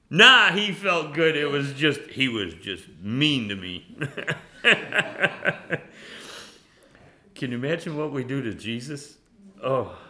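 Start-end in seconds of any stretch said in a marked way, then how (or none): 0.1-3.5 mock indignant retort. Offended churchgoer insisting the pastor felt fine, loud mock retort. 4.0-6.5 airy laugh high up; breathy high laugh. Real, not acted. Preacher laughing at his own impression, breathy laugh.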